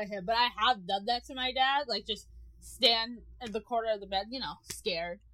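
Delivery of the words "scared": ordinary delivery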